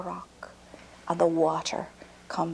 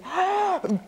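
much higher, louder gasp; sharp gasp; 11 semitones higher, 3 dB louder than usual. Acted. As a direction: gasp as teeth hit iron. Giant bites into iron cake.

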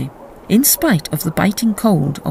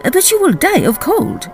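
much higher, urgent protest; expostulating; 12 semitones higher than usual. A narrator's reading. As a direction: plead against the open window. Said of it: "she expostulated".

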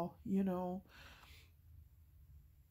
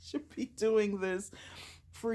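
laughing protest; 5 semitones higher, 4 dB louder than usual. Retold or imitated. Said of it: "And I'm like".

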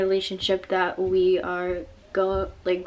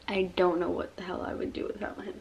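emotional whisper; 7 dB quieter, faster than usual. Real, not acted. Emotional tribute to her dad.

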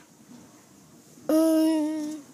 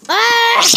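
pained scream; 12 semitones higher, 15 dB louder than usual. Pretend. A toy gets hurt.

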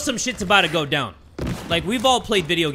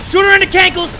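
high, loud frantic yell; excited shout; 12 semitones higher, 9 dB louder than usual. Real, not acted. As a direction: yell it mid-fight. Repeat shouted during fight.

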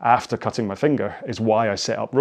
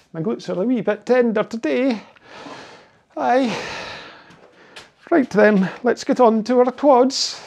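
higher, hammed-up Scottish accent; mock Scottish accent; 11 semitones higher than usual. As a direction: lay the Scottish accent on thick. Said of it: "I'm gonna get chased out of my own country".

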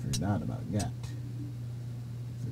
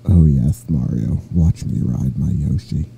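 Princess Peach; 10 semitones lower, 5 dB louder than usual. Pretend. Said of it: "I'm Princess Peach".

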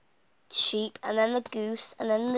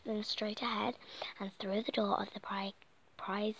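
whisper; 11 dB quieter than usual. Acted. Whispering the plan.

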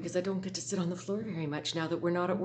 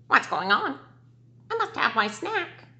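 higher quacking duck; a duck fussing about its snack; 7 semitones higher, 4 dB louder than usual. Acted. Teacher reading to her class, as a higher fussy duck. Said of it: "a duck begin to quack".

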